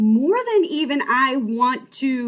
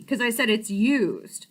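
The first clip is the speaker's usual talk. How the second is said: usual speaking voice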